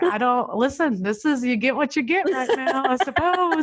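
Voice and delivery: high-pitched, fast flustered exasperation; exasperated mom. Retold or imitated. Mom answering coworkers on Zoom, flustered and high. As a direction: throw up your hands at the meeting, voice climbing.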